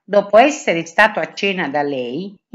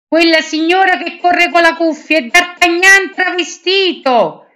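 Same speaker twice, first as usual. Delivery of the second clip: much higher, louder call down to the guards; shouting from the balcony; 10 semitones higher, 6 dB louder than usual. Acted. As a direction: yell to the guards below. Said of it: "gridò Milady dal balcone". (grido molto acuto e forte dal balcone)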